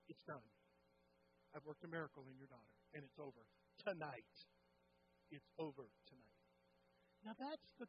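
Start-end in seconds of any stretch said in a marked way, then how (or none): 0.1-6.4 God speaking gently. Acted. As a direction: hush it like a promise being kept. God's words in retold testimony.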